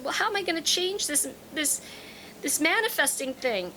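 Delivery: high-pitched imitation of a whiny student; whining. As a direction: whine it, mimicking someone upset all day. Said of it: "she'd been whining and".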